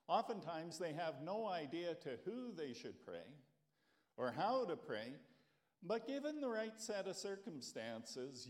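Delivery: normal talking voice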